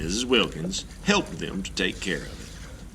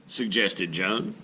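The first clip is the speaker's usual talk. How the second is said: usual speaking voice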